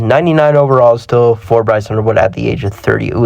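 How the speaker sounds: ordinary talk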